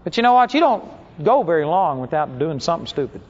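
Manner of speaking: ordinary delivery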